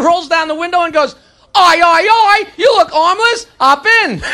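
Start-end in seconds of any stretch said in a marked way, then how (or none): 1.6-4.2 high, hearty British hail, drawn out; British accent. Acted. British driver hails the armless hitchhiker, high British accent. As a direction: call out slowly in a put-on British accent. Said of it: "A British guy pulls over".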